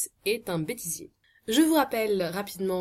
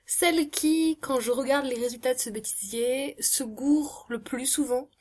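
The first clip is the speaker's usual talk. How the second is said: self-mocking; 6 semitones higher than usual. Self-mocking intro in odd voice.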